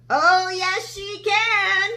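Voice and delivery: high, loud group of cheeky animals; chorus of animals. Acted. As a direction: chime in together, defiant. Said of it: "chorused the other animals".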